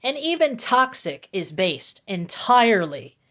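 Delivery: normal talking voice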